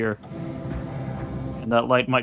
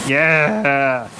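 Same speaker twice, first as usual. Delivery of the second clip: voice breaking; 7 dB louder than usual. Real, not acted. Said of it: "My voice broke".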